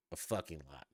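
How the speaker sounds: ordinary talk, nothing marked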